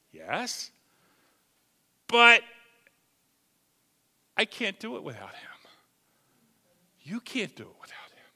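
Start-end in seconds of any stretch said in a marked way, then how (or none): 4.4-8.2 whisper. Acted. Whispered confession.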